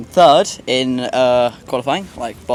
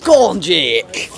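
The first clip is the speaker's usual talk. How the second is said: put-on slow, drawn-out northern accent cheer; 3 semitones higher, 4 dB louder, much slower than usual. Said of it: "Your northern accent needs some work".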